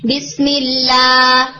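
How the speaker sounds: بسم اللہ slow, fully straight alif; straight fatha. Shown as a demonstration. Teacher demonstrating fatha sound. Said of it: "جو کہ یہ sound ہے".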